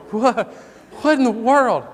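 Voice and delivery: high, loud, incredulous exclamation; baffled astonishment. Retold or imitated. Father sitting there as his son pops up, astonished. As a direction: retell a stunned outburst.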